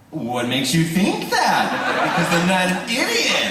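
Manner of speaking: high-pitched mock retort through laughter; laughing retelling. Retelling the Joker audition exchange, laughing.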